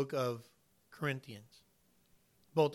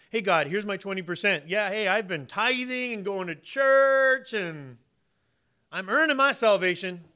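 louder, boastful show-off bragging to God; mock proud churchgoer; 3 semitones higher, 7 dB louder than usual. Churchgoer boasting to God about tithing, loud mock pride. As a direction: puff up, brag about your good works loudly. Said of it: "Anybody who says".

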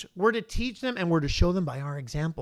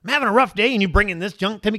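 loud, raised, overwhelmed divine rant; booming Jesus; 5 semitones higher, 8 dB louder than usual. Acted. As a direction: boom out an exasperated scolding from heaven. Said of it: "you hear this booming voice from him".

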